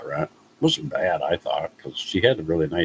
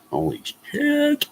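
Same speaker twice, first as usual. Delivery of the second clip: shocked; much slower than usual. Real, not acted. Reacting to weekly rent.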